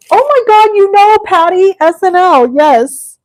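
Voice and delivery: loud, high-pitched real excitement; thrilled recognition. Real, not acted. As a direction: burst out, delighted someone gets the reference. Delighted someone knows the quote.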